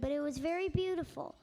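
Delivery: plain reading voice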